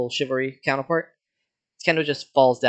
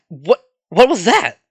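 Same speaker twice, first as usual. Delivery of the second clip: loud, high, stammered disbelief; startled demand; 5 semitones higher, 7 dB louder than usual. Acted. Kendo reacting to a sudden attack, startled demand. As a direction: blurt it out slowly, taken aback. Acted alarm during fight.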